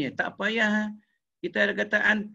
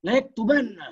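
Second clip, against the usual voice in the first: "yaktubanna" raised, with a held double n; emphatic intonation; 4 semitones higher, 4 dB louder than usual. Shown as a demonstration. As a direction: read "yaktubanna" high and emphatic, falling at the end. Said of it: "mesti ada intonasi dia baca". (baca "yaktubanna" dengan intonasi penegasan)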